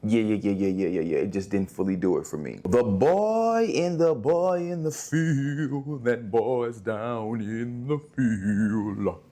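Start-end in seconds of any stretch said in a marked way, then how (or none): 2.6-9.2 slow, drawn-out title announcement; dramatic title read. Pretend. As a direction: intone the title slowly like a trailer. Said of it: "reads title in dramatic voice".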